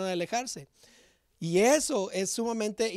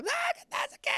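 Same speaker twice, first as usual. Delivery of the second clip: put-on whiny teen daughter; much faster than usual. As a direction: plead like a sulky teen girl defending her phone. Teen daughter protests again. (hija adolescente quejumbrosa, voz muy aguda y arrastrada)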